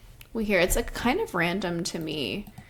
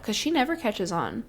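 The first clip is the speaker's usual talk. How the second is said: ordinary talk, nothing marked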